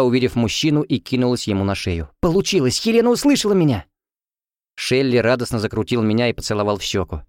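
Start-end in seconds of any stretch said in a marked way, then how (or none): 2.2-3.9 much higher, thrilled exclamation; excited cry. Acted. Girl telling Shelli it worked, excited. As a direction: burst out with the good news.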